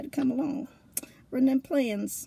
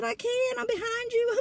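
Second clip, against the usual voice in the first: higher, half-laughing helpless reply; helpless protest; 11 semitones higher than usual. Retold or imitated. [name] answering panicked Marie, high helpless protest. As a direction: plead you can't do anything, high. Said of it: "And I said".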